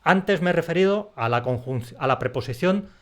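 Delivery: ordinary delivery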